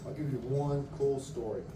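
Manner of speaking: ordinary delivery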